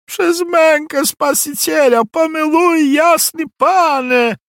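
high, loud desperate begging cry; pleading cry. A narrator's reading. Peasant girl crying out to the nobleman for mercy. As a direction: shriek for mercy at the lord's feet. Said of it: "krzyknęła raczej niż prosiła".

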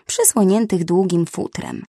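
usual speaking voice

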